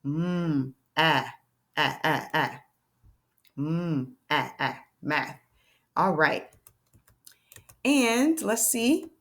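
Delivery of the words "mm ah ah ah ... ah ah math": quietly stretching the sounds of math; whisper-soft blend of m, ah, math; gentle phonics blending; sounding out math, soft. Shown as a demonstration. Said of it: "the sound I hear is ah".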